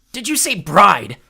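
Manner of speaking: slow, loud, high challenge; demanding shout. Acted. Clarice hanging over the pot, loud demand. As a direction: demand an answer. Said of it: "Clarice demanded".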